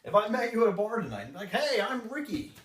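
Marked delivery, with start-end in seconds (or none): 1.5-2.5 high, drawn-out overeager hello; mock pushy salesman introducing himself; overeager greeter. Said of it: "walking to you with their hand out".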